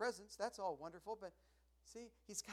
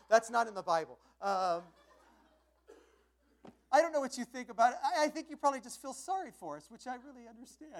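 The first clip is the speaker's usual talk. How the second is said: loud and higher, still amused; jokey, laughing banter; 7 semitones higher, 14 dB louder than usual. Real, not acted. Joking with the ladies.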